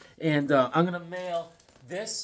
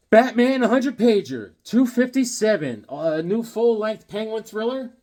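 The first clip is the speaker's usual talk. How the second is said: announcer voice; 8 semitones higher, 5 dB louder than usual. Pretend. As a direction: sell it like a comic cover. Announcing comic title.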